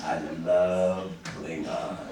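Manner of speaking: slow, savoring I love Klingons; Worthy lingering over his love of Klingons; drawn-out fond reply. Retold or imitated. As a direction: stretch love out slowly. Retelling Worthy's fond answer.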